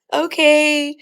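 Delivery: imitated girlfriend, pitched well up. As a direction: give in reluctantly, as a retold girlfriend. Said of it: "And she was like".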